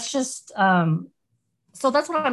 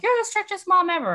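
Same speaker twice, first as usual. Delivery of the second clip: higher, mock-sulky kids; complaining kids; 12 semitones higher than usual. Kids protesting their strict mom, mock sulky. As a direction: grumble it like a sulking child. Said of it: "they're like".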